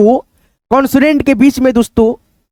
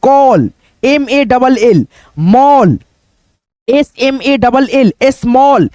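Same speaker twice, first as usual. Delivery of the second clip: slow spelling; much slower than usual. Shown as a demonstration. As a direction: spell call slowly, letter by letter. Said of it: "यहाँ पे सी ए double एल है".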